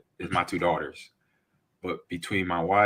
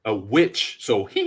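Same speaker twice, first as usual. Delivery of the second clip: usual speaking voice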